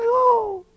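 very high, drawn-out oh; mock-fearful wail; frightened "oh". Reacting to granny's warning in retelling.

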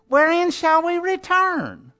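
high, slow, whiny plea; whining. Retold or imitated. Preacher mocks Israel questioning God, high whining. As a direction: whine it like a sulking child. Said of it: "You see, they're whining".

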